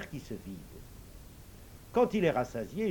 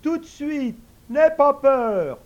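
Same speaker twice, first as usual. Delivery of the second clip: much higher, louder eager servant voice; invisible servant; 11 semitones higher, 13 dB louder, slower than usual. Acted. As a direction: answer the master high and ready. Servant reassures.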